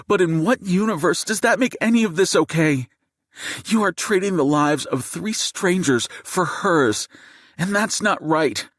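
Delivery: seething high-pitched outburst. A narrator's reading. Wife confronts the gunman holding her family, seething. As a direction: pour out furious, high indignation. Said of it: "Jade's seething voice shatters the silence".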